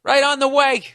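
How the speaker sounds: cheery government clerk. Retold or imitated. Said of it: "They go".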